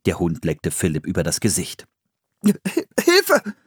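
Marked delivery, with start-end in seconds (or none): 2.4-3.5 stammered, high-pitched call for help; stutter, then squeal for help; frightened cry. A narrator's reading. Boy licked by a dog, crying out startled. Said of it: "rief Philipp erschrocken".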